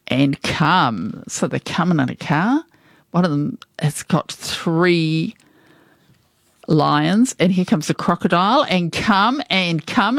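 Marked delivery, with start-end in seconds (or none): none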